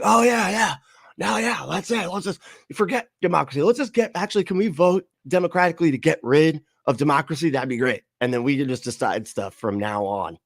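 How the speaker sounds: high, eager mock agreement, rushing; mocking eager voters. Streamer mocking voters who cheer ending democracy, high and eager. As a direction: ham up gleeful voters ditching democracy. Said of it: "They'd all be like".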